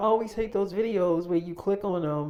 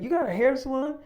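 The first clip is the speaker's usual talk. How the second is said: higher-pitched, mildly incredulous retold question; surprised question; 8 semitones higher, 4 dB louder than usual. Woman questioning a man's salon ownership, surprised. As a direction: imitate her disbelief, pitch jumping up. Sister doubts he owns a salon.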